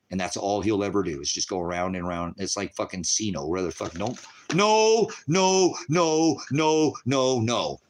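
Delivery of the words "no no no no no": high, loud repeated no, falling off. Real, not acted. Ranting to viewers, a loud repeated no. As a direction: shout down the idea.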